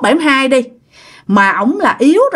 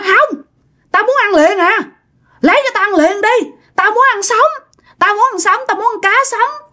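frantic, much higher demanding voice; ông Bảy hollering; 9 semitones higher than usual. Acted. Old man demanding raw food at once, high-pitched hollering. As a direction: insist over and over in a high-pitched clamour. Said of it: "ông Bảy lại la làng lên".

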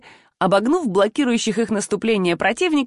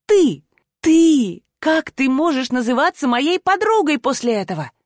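choked with anger, raised pitch; furious outburst; 6 semitones higher than usual. A narrator's reading. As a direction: explode in indignation. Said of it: "задыхаясь то ли от переполняющей её ярости".

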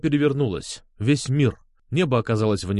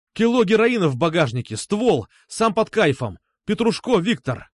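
higher, urgent run of facts; rattled off; 6 semitones higher than usual. A narrator's reading. Сергей reports Виктор to Пётр, urgent rattle. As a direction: rattle it off forcefully like a robot. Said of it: "протараторил я".

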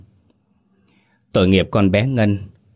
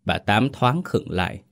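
usual speaking voice

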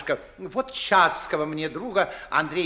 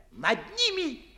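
ordinary delivery

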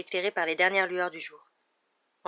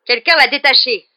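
loud, raised-pitch growled accusation; angry growl; 6 semitones higher, 15 dB louder than usual. A narrator's reading. Executioner suspecting someone, growling. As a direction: growl it out loudly. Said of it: "grogna le bourreau".

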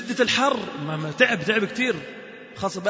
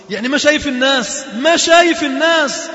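emphatic preaching; 8 semitones higher, 10 dB louder than usual. Acted. Preacher to the congregation, loud emphatic stress. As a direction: drive home that he sees no one.

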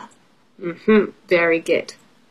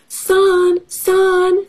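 high, drawn-out call; pig calling out; 11 semitones higher than usual. Acted. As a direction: call down into a well in a storybook. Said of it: "said pig".

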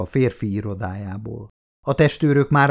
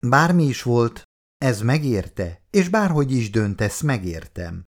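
usual speaking voice

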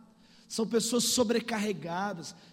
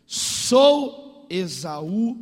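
impostor son; 2 semitones lower, 9 dB louder, much slower than usual. Retold or imitated. Acts Jacob answering his father.

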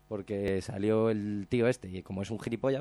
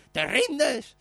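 high, louder shouted taunt; 7 dB louder than usual. Retold or imitated. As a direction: call it out after the fleeing owner. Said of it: "gritándole por las calles". (grito burlón imitado)

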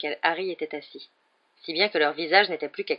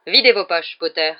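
louder, abrupt command; brusque order; 9 dB louder than usual. A narrator's reading. Snape abruptly orders Harry to empty his pockets. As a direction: bark it suddenly. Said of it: "lança-t-il brusquement".

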